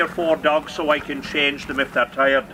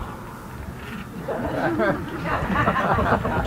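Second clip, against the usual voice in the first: laughing; 8 semitones higher than usual. Real, not acted. Laughs at the dog.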